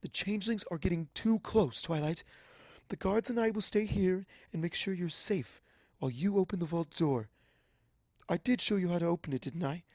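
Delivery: high, urgent princess voice; Princess Celestia. A narrator's reading. Celestia urges Twilight to open the vault, high and urgent. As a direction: press the student with regal urgency. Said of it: "asks Celestia".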